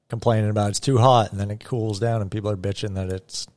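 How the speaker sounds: ordinary talk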